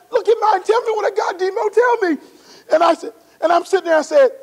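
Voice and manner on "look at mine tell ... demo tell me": higher, laughing brag to a teammate; playful teasing. Retold or imitated. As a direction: show off playfully, pushing him to guess. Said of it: "This is the comedian in Barry".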